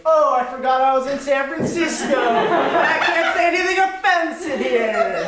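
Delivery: sarcastic recovery. Pretend. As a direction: oversell the fake apology. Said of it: "I will make up for it by saying".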